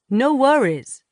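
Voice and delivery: put-on young man with a Boston accent, easygoing. A narrator's reading. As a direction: toss it off in a cheerful Boston accent. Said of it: "in a Boston accent".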